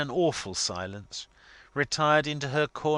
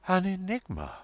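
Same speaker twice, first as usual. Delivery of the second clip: dignified; 9 semitones higher than usual. A narrator's reading. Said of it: "A dignified shiver".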